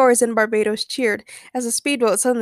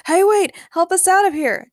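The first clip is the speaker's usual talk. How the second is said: high, loud call for help; acted shout across the water; 10 semitones higher, 5 dB louder than usual. A narrator's reading. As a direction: call out urgently, pitched up. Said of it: "James shouted from the yacht".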